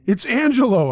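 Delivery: high, loud, urgent call; shouted. A narrator's reading. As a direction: shout to be recognised on the phone. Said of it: "I shouted".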